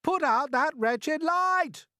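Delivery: high, slow furious shout; angry bug shouting in the dark. Acted. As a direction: roar it up at the ceiling. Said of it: "he shouted".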